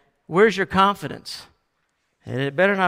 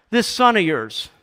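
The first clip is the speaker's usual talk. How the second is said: raised, loud, falling accusation; accusing jab; 4 semitones higher, 5 dB louder than usual. Acted. Elder brother disowning his brother to dad, accusing. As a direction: fling it at him as a final reproach. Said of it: "he lets him have it".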